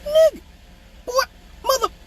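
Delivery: very high, broken sputtering; mock speechless outrage. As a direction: sputter in squeaky disbelief, halting between words. Acted speechless fury at the laughing kid.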